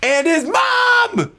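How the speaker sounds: high, slow shouted add-on; big excited shout-out. Real, not acted. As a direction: call it out with glee.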